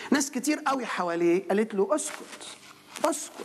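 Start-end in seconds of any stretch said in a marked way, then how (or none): 1.9-3.3 hushing. Acted. Crowd hushing the blind man.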